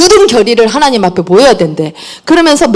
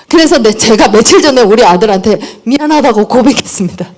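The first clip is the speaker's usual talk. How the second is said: ordinary delivery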